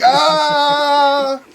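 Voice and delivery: high laughing "Ah!" burst; mock dazed cry. Acting out smoking dust.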